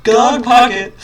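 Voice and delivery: high, loud cheer of the name; excited shout. Acted. Dinosaur kids cheering their pocket name, high and loud.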